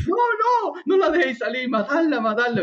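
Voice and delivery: high, urgent cries fading down; frantic pleading. Retold or imitated. Edu behind the door, frantic high pleading. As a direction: panic then insist on killing it. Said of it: "Y el Edu".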